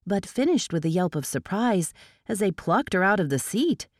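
plain reading voice

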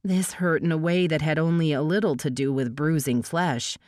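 ordinary delivery, nothing marked

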